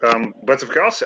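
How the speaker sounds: but of course in a French lilt, rising at the end; French accent. Acted. Remy agreeing to help, French accent. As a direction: suave French scholar. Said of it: "that was pretty good. I loved it".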